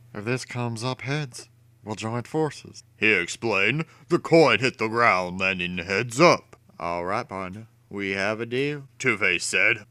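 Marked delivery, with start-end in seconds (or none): 0.1-2.8 softer, menacing villain; a hint of a scheming villain; Two-Face voice. A narrator's reading. 6.8-8.9 softer, menacing villain; a hint of a scheming villain; Two-Face voice. A narrator's reading.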